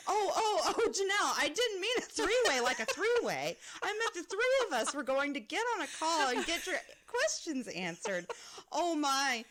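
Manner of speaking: high, loud, breaking with laughter; laughing panic. Retold or imitated. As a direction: squeal through laughter. Panicked apology retold, laughing.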